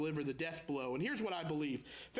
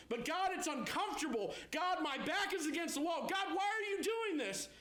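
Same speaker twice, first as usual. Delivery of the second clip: whiny pleading believer; 8 semitones higher than usual. Acted. Believer complaining to God.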